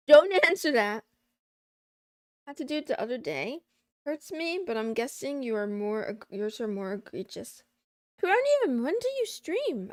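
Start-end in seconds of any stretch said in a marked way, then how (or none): 0.1-1.0 "Don't" shouted much louder, falling at the end. Shown as a demonstration. 8.2-9.9 high, loud excited greeting; excited call out. Real, not acted. Excitedly calling out a viewer.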